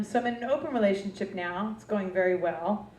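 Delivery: usual speaking voice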